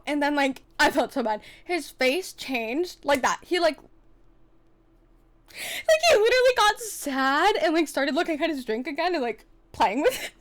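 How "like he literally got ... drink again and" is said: high, loud mock whimper; hamming up his sulk with a fake whimper; mock crying. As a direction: fake a sob for his sad face. Mock crying over his sadness.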